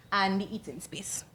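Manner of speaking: whispered aside; whispering. Real, not acted. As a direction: whisper to yourself. Presenter loses place, whispers.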